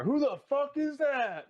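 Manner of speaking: high-pitched, hammed-up cry of alarm; startled radio townsperson. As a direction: squawk it like a freaked-out townsperson. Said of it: "you just kinda hear".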